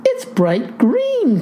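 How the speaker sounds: slow, high-pitched astonishment; shocked cry. A narrator's reading. Clerk sees the farmer's changed hair, shocked cry. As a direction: stretch it out in high alarm. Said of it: "cries she".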